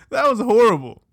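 high, loud, laughing disbelief; amused outrage. Real, not acted. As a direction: crow it out through real laughter. Laughing verdict on the accent.

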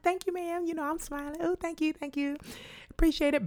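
high, soft, polite little thank-you; sweetly polite, smiling through it. Retold or imitated. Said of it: "Being polite".

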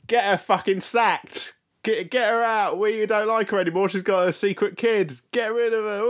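high, jeering gossip voice; mocking nasty co-workers. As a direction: jeer like spiteful factory women.

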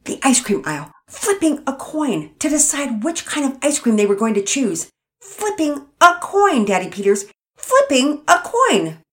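mother venting to her husband; irritable mother. Acted. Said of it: "growing more irritable as she spoke".

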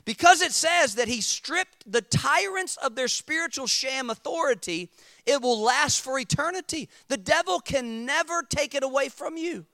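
loud, high-pitched sermon delivery; preaching at full force. Real, not acted. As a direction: drive each phrase home to the congregation.

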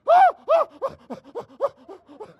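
a high, loud shriek that falls away; screaming imitation that trails off; imitated scream fading. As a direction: mimic the scream, then let it die down. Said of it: "She started screaming".

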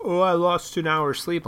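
high, whiny mock complaint; mock whine from people losing an hour; whiny complainer. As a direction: mimic a whining sleeper. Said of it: "everybody freaks the fuck out".

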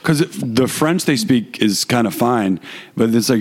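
ordinary delivery

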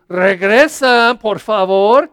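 father calling out. Retold or imitated. Father calls the runaway child back, loud and slow. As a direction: call the child back across the house. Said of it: "Y el padre dice".

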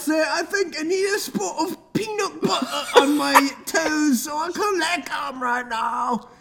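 put-on high, hammy British accent. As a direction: play Abe as a British toff. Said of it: "That's Abe if you were British".

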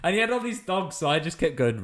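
ordinary delivery

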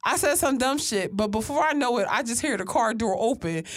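ordinary talk, nothing marked

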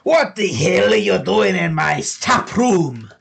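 high, loud, slow halfling shout; angry halfling. Acted. Tavern halfling yelling at intruders in his cellar, angry high shout. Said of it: "A halfling comes out ... and he says".

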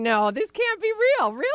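high, loud giggly protest; giggling disbelief. Retold or imitated. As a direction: act out giggling incredulity. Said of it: "I start giggling, going".

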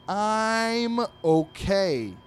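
very high, loud, very slowly drawn-out I'm okay; angry dog. Acted. Comic dog snaps at his worried owner, strained high yell. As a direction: strain it out like you're about to burst.

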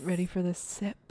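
soft teasing whisper; ASMR whisper. Acted. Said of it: "A S M R experience".

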